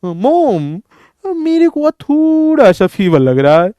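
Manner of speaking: a lightly voiced pampered bride; lazy daughter-in-law. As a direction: mumble the excuse from under the blanket. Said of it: "फिर बहू कहती है".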